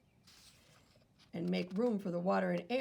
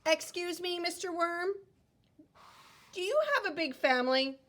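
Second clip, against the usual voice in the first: put-on high, polite kid asking; child politely asking Mister Worm, high; 8 semitones higher, 3 dB louder than usual. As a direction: pitch up, ask sweetly.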